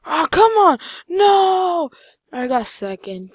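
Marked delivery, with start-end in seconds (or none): 0.0-1.9 loud, high-pitched protest; frustrated shout. Real, not acted. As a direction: yell it out in frustration at losing. Loses the round at the end.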